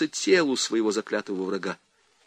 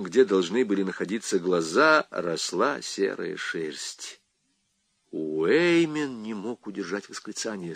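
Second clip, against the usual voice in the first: ordinary delivery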